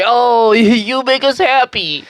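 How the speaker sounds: high, drawn-out sappy voice; mock gushing. Family praising her fake power at the end, mock sappy. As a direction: gush it sweetly in mockery.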